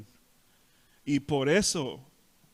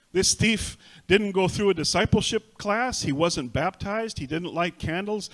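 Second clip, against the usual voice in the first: louder, higher preaching punches; forceful preaching; 5 semitones higher, 6 dB louder than usual. Acted. Preacher tells the church what the thief never did, forcefully. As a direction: hammer each line at the crowd.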